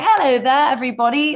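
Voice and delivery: badly faked London Cockney, pushed hard; attempted Cockney accent. Shown as a demonstration. American guest showing her best London accent, amateur Cockney. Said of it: "it comes out a bit Cockney".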